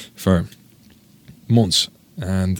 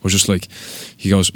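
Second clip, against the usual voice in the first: ordinary talk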